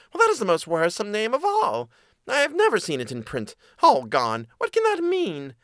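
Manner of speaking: high mouse voice, highest on the last question; worried mouse. Acted. Mouse troubled by the third name, high and worried. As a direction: fret high as a puzzled mouse.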